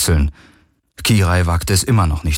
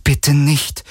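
higher, restrained plea; a touch of begging; pleading; 6 semitones higher than usual. A narrator's reading. Kirai silently begs not to be betrayed, pleading. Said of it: "flehte Kirais Blick".

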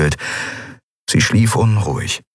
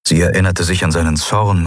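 plain reading voice

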